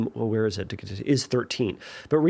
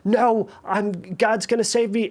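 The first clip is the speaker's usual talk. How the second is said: insistent protest; 8 semitones higher, 4 dB louder than usual. Retold or imitated. Man refuses rescue, water rising.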